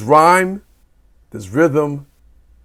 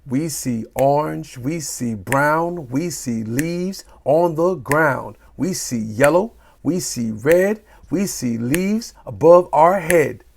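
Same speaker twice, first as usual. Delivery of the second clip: slow, beat-marked sing-song; rhythmic reading. Shown as a demonstration. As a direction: tap out the rhythm of "We see orange". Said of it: "What about rhythm".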